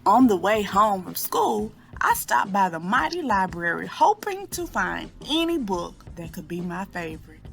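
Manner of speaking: ordinary delivery, nothing marked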